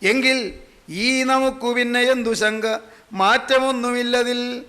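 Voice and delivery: slow, sustained chant-like delivery; verse recitation. Acted. Preacher reciting verse on passing great men, slow declaiming. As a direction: intone the verse slowly, drawing out the syllables.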